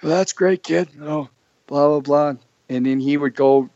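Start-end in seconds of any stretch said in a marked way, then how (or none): 0.0-2.4 a higher, lightly voiced Johnny; wrestling boss praising a rookie, light impression. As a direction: tease the kid like the boss. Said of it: "everybody has their own Johnny impersonation".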